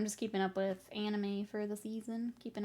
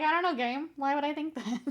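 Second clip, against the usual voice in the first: lightly acted protest, higher and louder; mock-innocent retort; 5 semitones higher, 4 dB louder than usual. Player answers the game back, mock-innocent. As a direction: play up innocent bafflement, pitched up.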